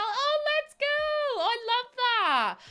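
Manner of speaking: squealing with delight at chat's news; excited squeal. Real, not acted. Streamer cheering a viewer's good news, excited squeal.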